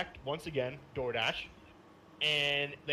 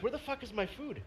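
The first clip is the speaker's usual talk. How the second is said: higher-pitched complaint at usual volume; incredulous retold demand; 9 semitones higher than usual. Customer retelling his demand for his food, incredulous. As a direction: pitch up a retold, exasperated question.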